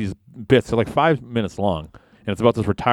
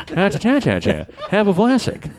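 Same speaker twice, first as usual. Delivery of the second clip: ad mascot voice; 12 semitones higher than usual. Retold or imitated. Vlasic stork ad impression.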